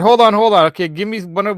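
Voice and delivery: usual speaking voice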